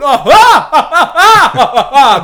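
big, high mock guffaw; loud put-on belly laugh in bursts; imitated loud laughter. Mark laughing backstage, imitated loud guffaw. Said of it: "I hear someone laughing".

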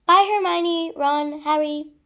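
high, loud farewell; calling goodbye. A narrator's reading. Girl leaving her new friends, calling goodbye. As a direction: call out to each friend.

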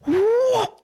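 high rising "whoop"; quick high vocal sound effect; imitated door noise. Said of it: "And we hear this".

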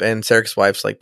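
ordinary talk, nothing marked

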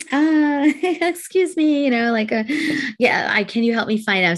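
high, halting polite appeal; hesitant request. Retold or imitated. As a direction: imitate a lost tourist stumbling over a request. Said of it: "I went to a police officer and I said".

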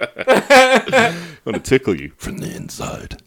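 slow, creepy villain purr; slow theatrical villain menace. Pretend. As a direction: menace them slowly.

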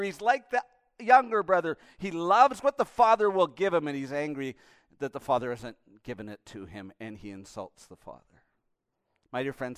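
usual speaking voice

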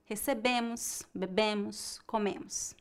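"recebemos", "bebemos", "comemos" with the final O S barely voiced; faint, reduced ending on "comemos"; weak final -mos. Shown as a demonstration. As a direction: stress the penultimate syllable and let "-mos" drop away. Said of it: "pronúncia das letras O S no final é bem fraca".

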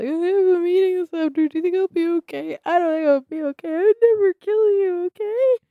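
high, loud, panicked sobbing plea; crying. Acted. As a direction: wail it out, tearful and desperate. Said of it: "I'm crying into him".

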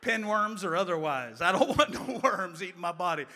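high, very loud acted refusal; hammed-up childish outcry; loud mock protest. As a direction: belt it out like a panicked kid, played for laughs. Retold frightened child's protest, shouted.

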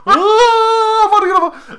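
a camp villain announcing himself; hammed-up Voldemort. Voldemort at the door, hammed-up dramatic self-announcement.

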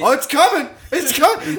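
high-pitched, loud mock warning; hammed-up threat. Telling friends about his cocked-back fist, hammed-up threat. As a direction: wind up a punch in a high, excited voice. Mimes winding up a punch.

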